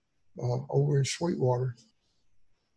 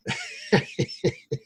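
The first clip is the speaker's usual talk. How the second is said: high wheezy chuckle; laugh. Real, not acted. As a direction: let out an amused, high-pitched laugh. Chuckles after accent remark.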